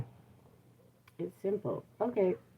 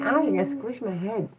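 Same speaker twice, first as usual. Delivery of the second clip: ordinary delivery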